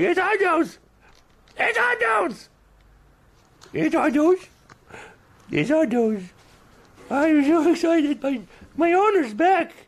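extremely high squealing animal voice; overexcited pet. Acted. Pet greeting its returning owner, very high excited squeal. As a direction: squeal like a pet beside itself with joy. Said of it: "Somebody's really excited over here. He's like".

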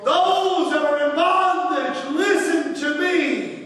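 loud, high proclamation voice; proclaiming Jesus. Acted. Jesus proclaiming liberty to the captives, loud. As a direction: declare it like a prophet. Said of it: "He says".

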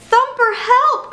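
high, drawn-out squeal of alarm; cry for help. A narrator's reading. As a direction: call out high and urgently to be rescued. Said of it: "cried one of his sisters".

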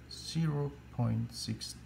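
usual speaking voice

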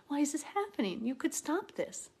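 high, pleading voice; anguished questioning. Retold or imitated. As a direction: plead upward as one who's hurt. Hurting believer pleading like Mary.

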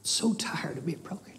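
hushed, barely voiced whisper; worn-down, hurting whisper; broken whisper. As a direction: whisper it as if it's all you can work up. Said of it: "all you can work up is a whisper".

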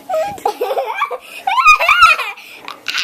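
loud high tickled shriek; shrieking laugh. Real, not acted. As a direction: burst into a screaming laugh. Child being tickled.

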